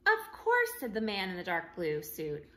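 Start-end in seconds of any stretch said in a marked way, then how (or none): 0.1-0.7 much higher, louder; bright of course. Acted. Official reassures Jake, bright high voice. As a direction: toss it off brightly.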